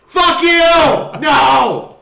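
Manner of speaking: loud, high outburst; shouted refusal. Real, not acted. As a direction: yell it in defiance. Sudden loud outburst at friends.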